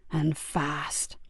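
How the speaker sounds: cold whisper; hushed, menacing whisper; whispered order. Acted. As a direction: breathe the command. Villain orders the killing.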